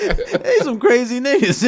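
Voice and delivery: loud, slow, laughing through the words; real laughing talk. Real, not acted. Friend joking with co-hosts about the Browns, laughing.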